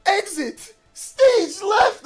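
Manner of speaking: Snagglepuss exit line. Retold or imitated. Answering mom about his first day, wailing cartoon exit line. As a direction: belt it out dramatically as you flee the room. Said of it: "Yeah, Snagglepuss".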